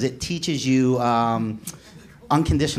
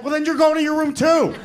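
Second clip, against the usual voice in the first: high-pitched angry dad ruling; acted parental outburst; exasperated dad yell; 13 semitones higher than usual. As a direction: send the other kid off too, fed up. Dad punishing second son.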